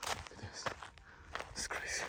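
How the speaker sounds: breathless whisper of awe; awed whisper. Real, not acted. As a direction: whisper it in wonder. Awed at the view.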